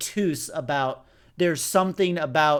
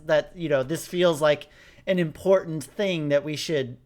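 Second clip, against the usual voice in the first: normal talking voice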